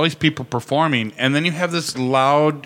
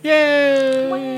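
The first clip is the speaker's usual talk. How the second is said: cheering; 13 semitones higher than usual. Real, not acted. Cheering news of show role.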